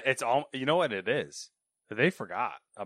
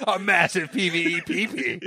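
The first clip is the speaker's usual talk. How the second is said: laughing; 8 semitones higher, 8 dB louder than usual. Acted. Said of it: "repeating the trailer line".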